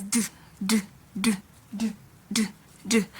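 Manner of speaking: d voiced as a short "du"; D sound demo. Shown as a demonstration. Teacher showing how the D letter is pronounced, D sound demo. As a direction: repeat d as isolated "du", evenly spaced. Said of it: "It is pronounced as".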